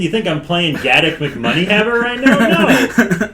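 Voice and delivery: outraged denial. Retold or imitated. Player denying he would kill a kid, indignant. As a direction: protest hotly, with a laugh creeping in.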